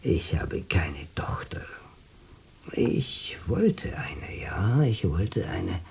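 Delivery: put-on hushed, breathy old man's confession; half-whispered longing. Old man confides softly.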